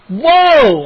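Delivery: loud high yelp of surprise. A narrator's reading. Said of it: "I yelped".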